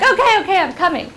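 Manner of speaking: high, loud acted reassurance; mock hurried reply. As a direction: imitate a teacher answering a yelling pupil. Teacher answering a shouting pupil.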